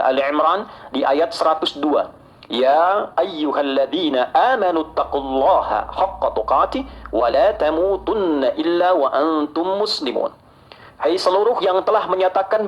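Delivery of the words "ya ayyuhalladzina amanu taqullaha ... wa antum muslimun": slow, drawn-out Arabic verse recitation; Quran recitation. Real, not acted. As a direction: recite the verse slowly, lengthening the vowels. (tilawah ayat Al-Quran yang pelan)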